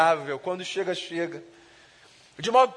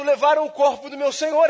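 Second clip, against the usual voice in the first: raised, louder tearful cry; Mary weeping at the tomb, raised and tearful; weeping plea; 6 semitones higher, 6 dB louder than usual. A narrator's reading. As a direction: cry it out in grief. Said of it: "Mas ela chora, dizendo".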